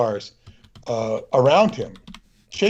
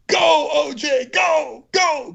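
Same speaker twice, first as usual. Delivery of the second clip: high-pitched cheering shout, much higher; excited fan shout; much slower than usual. Retold or imitated. Caller on the news line cheering for O J, high excited shout. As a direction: yell it like a caller cheering him on. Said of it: "the voice goes".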